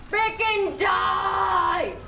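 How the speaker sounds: slow, drawn-out yell at the enemy; frustrated shout. Real, not acted. Gamer yelling at a boss, drawn-out frustrated shout. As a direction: bellow it at the boss, dragging each word.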